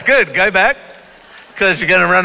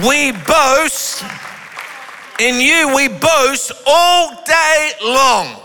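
raised, fervent sermon delivery; preaching; 5 semitones higher than usual. Acted. Pastor preaching Psalm forty-four to the congregation, fervent. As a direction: drive the scripture home to the church.

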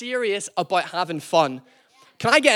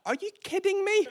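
imitating the wife; 11 semitones higher, 4 dB quieter than usual. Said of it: "Is like".

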